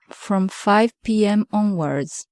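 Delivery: ordinary talk, nothing marked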